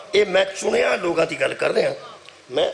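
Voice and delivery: ordinary talk, nothing marked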